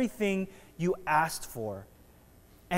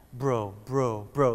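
hushed lower envious bro; talking to yourself; 6 semitones lower, slower than usual. Retold or imitated. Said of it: "you're talking to yourself".

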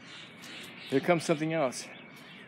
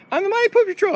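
high-pitched little hero; boastful dog voice; 4 dB louder than usual. Pretend. Patrol dog announces itself, high and boastful.

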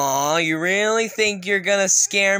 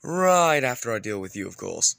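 much lower, softer threat; menacing aside; 11 semitones lower, 5 dB quieter than usual. Acted. Engine turning on the monster, low menace. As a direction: promise payback, low and cold.

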